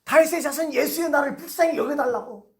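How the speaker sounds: acted high-pitched plea for mercy; crying out. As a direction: call out desperately toward someone unseen. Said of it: "소리 나는 쪽으로 무조건 소리치는 거예요".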